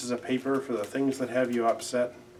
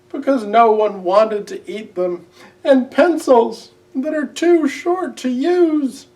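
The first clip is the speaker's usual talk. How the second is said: much higher, louder, wailing little owl; crying Owl; 11 semitones higher, 10 dB louder than usual. A narrator's reading. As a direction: wail it high and loud, slowing at the end. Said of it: "he cried".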